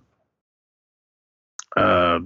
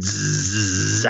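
drawn-out electrical zap noise; mimicked electrocution sizzle; imitated electric buzz; 4 dB quieter than usual. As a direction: buzz like a shorting power box. Crowbar goes into power box.

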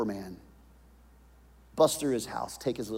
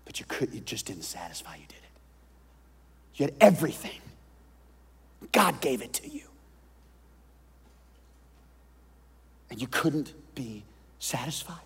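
hushed whisper with a raised line between; 4 dB quieter than usual. Acted. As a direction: whisper the charge close to the ear. Preacher confronts, hushed.

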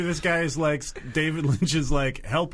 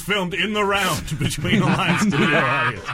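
announcer voice; 7 semitones higher, 6 dB louder than usual. Acted. Cuts in with announcer line.

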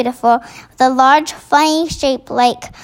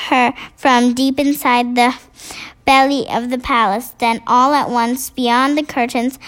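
usual speaking voice

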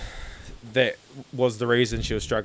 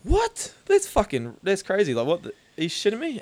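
much higher, What? shot right up in shock; shocked disbelief; 8 semitones higher than usual. Retold or imitated. Retelling his reaction to wife's news, high-pitched disbelief. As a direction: react like you can't believe your ears. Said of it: "I was like".

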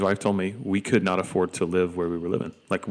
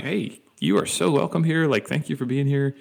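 high warm welcome; 6 semitones higher than usual. Retold or imitated. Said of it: "I'm like".

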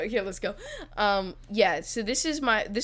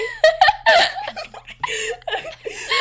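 breaks up in a loud, high laugh; helpless laugh that breaks the scene; high burst of laughter; 8 dB louder than usual. Real, not acted. Brother laughing as the sister kills off his character. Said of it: "I wanted to see what you would do".

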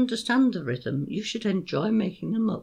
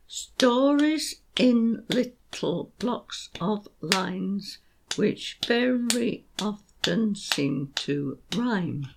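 very slow, every beat spelled out; slow rhythmic recital; much slower than usual. Shown as a demonstration. Teacher rereading her couplet slowly to show its rhythm. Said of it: "which is a bit slow".

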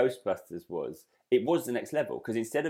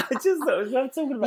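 laughing; 4 dB louder, faster than usual. Real, not acted. Innocent teasing excuse.

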